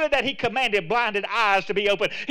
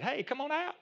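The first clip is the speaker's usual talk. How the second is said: calling out; 5 semitones higher, 8 dB quieter than usual. Acted. Voicing Jesus calling Peter out.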